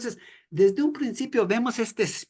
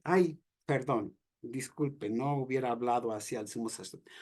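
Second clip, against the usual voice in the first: softer, small and sheepish, backing down; mock meek apology; 6 semitones lower, 7 dB quieter than usual. Preacher imagining a cowed Jesus apologizing to the soldier, meek and quiet. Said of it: "en lugar de decir".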